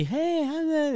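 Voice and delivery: friendly dog greeting. Shown as a demonstration. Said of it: "you're supposed to be".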